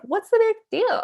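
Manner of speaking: high, loud, incredulous brush-off; exaggerated imitation of someone unimpressed; mock dismissive. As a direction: act out a careless shrug in the voice. Imagined dismissive reply to friend.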